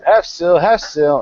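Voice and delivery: sailors passing the order along; sounding off. Acted. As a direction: echo the order as crew. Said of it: "hear them sound off saying the same thing".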